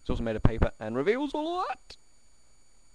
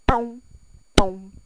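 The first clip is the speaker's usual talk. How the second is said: loud high booms; boom sound effect; 12 semitones higher, 11 dB louder than usual. Acted. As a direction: shout boom like an explosion.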